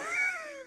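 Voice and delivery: short high burst of laughter; real high laugh. Real, not acted. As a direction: giggle up high. Laughing at own story.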